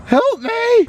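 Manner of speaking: high panicked cry for help; sinking in quicksand. Pretend. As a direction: pretend you're going under. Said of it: "then you pretend you're sinking in quicksand".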